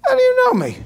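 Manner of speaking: put-on very high, comic Brooklyn accent; shriek-high New Yorker disbelief; hammed-up squeaky Brooklyn question. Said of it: "thick Brooklyn accent here".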